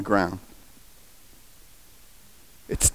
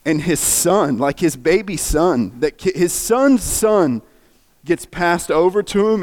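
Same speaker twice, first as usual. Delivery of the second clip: normal talking voice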